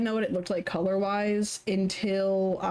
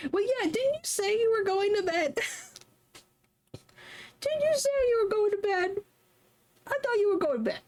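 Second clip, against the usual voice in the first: much higher, playful teasing voice; 14 semitones higher, faster than usual. Real, not acted. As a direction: poke fun at a viewer in a squeaky tone. Teasing a chatter, high voice.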